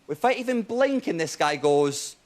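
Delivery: ordinary talk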